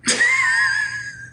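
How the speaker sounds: very high squealed cackle; villain cackle. Acted. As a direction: squeal out a mad villain laugh. Villain laugh amid Joker impressions.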